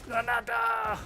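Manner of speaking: very high shrieked shout; squealed shout. Pretend. Player suddenly shrieks a silly battle cry, very high. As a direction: shriek the word out of nowhere.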